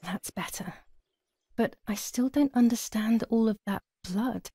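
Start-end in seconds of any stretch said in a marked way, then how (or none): 0.0-0.7 breathy relieved whisper; hushed relief to herself. Acted. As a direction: breathe it out under your breath. Alone, relieved, under her breath.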